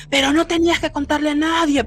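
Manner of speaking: high-pitched, forceful reproach; imitating a scary man's angry scolding; strong horrible voice. The duende scolds the boy over the broken deal, forceful horrible voice. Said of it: "era una voz fuerte, horrible".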